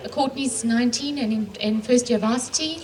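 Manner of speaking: ordinary talk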